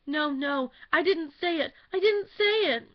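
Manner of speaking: high, loud and rising frantic denial; a frightened girl crying no; panicked denial. A narrator's reading. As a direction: take it back in a panic. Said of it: "she at once corrected herself".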